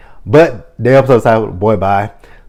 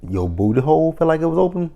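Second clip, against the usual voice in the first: higher, rising disbelief on "open"; incredulous question; 7 semitones higher, faster than usual. Retold or imitated. Quoting her reaction to the client, incredulous. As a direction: ask it stunned. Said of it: "I'm like".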